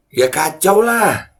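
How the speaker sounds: high, slow, disbelieving cry; exasperated outburst. Real, not acted. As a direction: drag it out in dismay. Outraged at the report.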